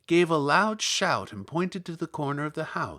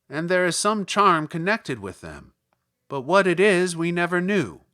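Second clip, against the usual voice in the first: louder little Munchkin voice; 3 semitones higher, 7 dB louder than usual. Acted. Munchkin tells Dorothy about the shoes, louder. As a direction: speak up like an eager small villager.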